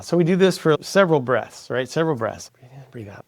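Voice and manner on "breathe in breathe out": hushed breathing cue. Shown as a demonstration. Demonstrating meditation breathing.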